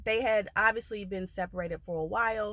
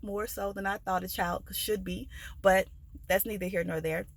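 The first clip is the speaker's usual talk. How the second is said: ordinary delivery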